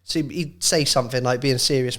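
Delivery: ordinary delivery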